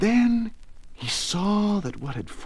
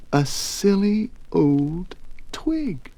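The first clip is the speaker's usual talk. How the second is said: plain reading voice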